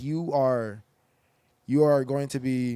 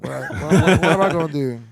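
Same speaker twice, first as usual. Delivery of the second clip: loud, laughing helplessness; laughing protest; 4 semitones higher, 12 dB louder than usual. Real, not acted. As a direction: laugh through the words, louder and higher. Jokes he is useless in a fight.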